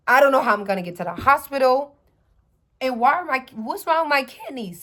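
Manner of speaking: frantic worry about the hospital; panicked. Retold or imitated. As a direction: relive the panic, loud and high. Panicking over kidney diagnosis.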